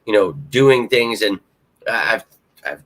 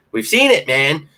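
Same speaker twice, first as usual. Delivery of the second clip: much higher and louder, almost a shout; excited exclaim; 6 semitones higher, 4 dB louder than usual. Real, not acted. Fan insists to viewers the promise is real, excited near-shout. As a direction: burst out insisting fans already saw it.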